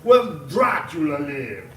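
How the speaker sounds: slow, loud mock Transylvanian accent. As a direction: draw it out like Dracula. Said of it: "doing a Transylvanian accent".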